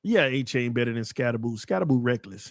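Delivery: ordinary talk, nothing marked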